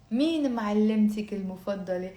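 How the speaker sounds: brisk natural pace. Shown as a demonstration. Teacher giving the question at full speed. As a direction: say it quickly like a native. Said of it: "very slowly and then faster".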